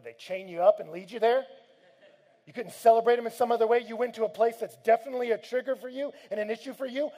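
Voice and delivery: loud, high, incredulous rebuke; mock confrontation. Preacher confronting a man's excuse, loud incredulous rebuke. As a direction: tear apart the lame excuse, shouting.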